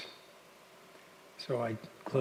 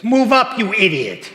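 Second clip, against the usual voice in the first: much higher, much louder angry shout; furious yell; 9 semitones higher, 17 dB louder than usual. Retold or imitated. Driver yelling out of his SUV at the speaker, furious shout. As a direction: bellow it at the car ahead.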